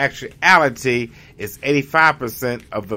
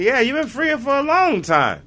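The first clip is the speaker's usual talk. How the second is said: messenger; 13 semitones higher than usual. Retold or imitated.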